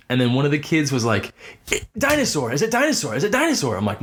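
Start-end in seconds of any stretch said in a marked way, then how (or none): 1.7-3.8 put-on much higher, insistent kid voice; eager kid. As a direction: blurt it out like a kid sure he's right. Kid repeatedly guessing dinosaur.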